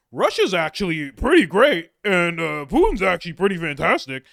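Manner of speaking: high, loud mocking voice of a Putin fan; mocking Russia fan. Mocking Putin fans on a podcast, high loud put-on voice. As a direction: sneer it up high like a gushing fanboy. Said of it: "Some people are like".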